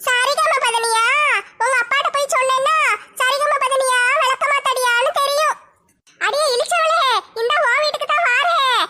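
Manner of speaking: high-pitched threatening tirade; angry scolding. Acted. Aunt threatens to report the serenade at home, scolding angrily. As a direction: rail at them, pitched well up and stretched at phrase ends.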